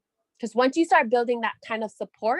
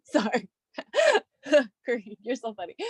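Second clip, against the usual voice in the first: laughing. Real, not acted. Cracking up at Kareem.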